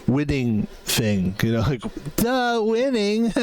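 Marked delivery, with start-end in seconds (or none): 2.2-3.3 slow, much higher mock-dopey voice; Charlie Sheen impression. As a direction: play a spaced-out celebrity bragging. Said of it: "like, a Charlie Sheen, like, you know, winning thing".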